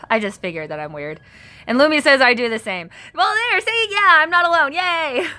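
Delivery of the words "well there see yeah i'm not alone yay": high, louder happy cheer; excited vindication. Real, not acted. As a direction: celebrate finding a kindred spirit. Celebrating a viewer who does the same.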